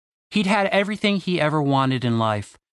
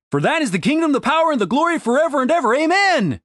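rushed fervent prayer; 12 semitones higher, 4 dB louder, faster than usual. A narrator's reading. Prayer climax, fast.

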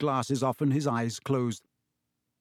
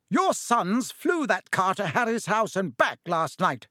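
shouting; 6 semitones higher than usual. Acted. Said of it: "shouted Missus Weasley".

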